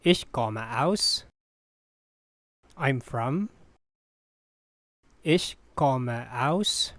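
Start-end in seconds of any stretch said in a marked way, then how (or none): 5.2-6.9 slow, word by word, left hanging; careful teaching model with rising end; slow repeat. Shown as a demonstration. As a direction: space it out for learners. Teacher repeats slowly for learners.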